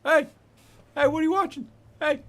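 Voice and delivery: high, loud talking-dog yaps; squeaky, shouted dog impression; excited dog. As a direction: bark out words like a pestering dog. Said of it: "Your dog is like".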